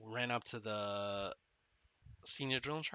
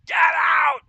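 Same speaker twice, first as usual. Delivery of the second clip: screaming, far higher and much louder; drill instructor yelling; 16 dB louder than usual. Retold or imitated. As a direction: blow up and scream him away. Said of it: "the drill instructor's like".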